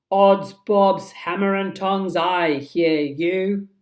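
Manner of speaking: a mock pirate captain, loud and slow; imitating Hook. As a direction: mimic the captain's bluster. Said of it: "in the very voice of Hook himself".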